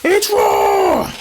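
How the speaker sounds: high-pitched mock chef yell; hammed-up catchphrase shout; Gordon Ramsay. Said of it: "he did say that once".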